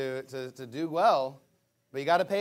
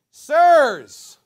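dramatic cry; 8 semitones higher, 16 dB louder than usual. Acted. Jailer's cry in read verse.

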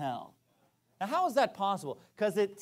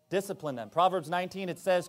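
ordinary delivery

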